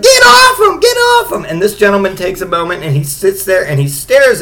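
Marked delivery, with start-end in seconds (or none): 0.0-1.4 very high, loud shrieking shout; hammed-up high shriek; mock girlfriend screaming. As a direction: scream it at the brawling boys. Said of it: "She's like".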